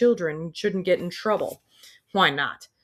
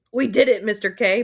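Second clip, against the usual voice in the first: loud, higher triumphant crow; 4 semitones higher, 7 dB louder than usual. Acted. Student crowing in triumph to the teacher, loud. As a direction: gloat to the teacher. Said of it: "Parker crows".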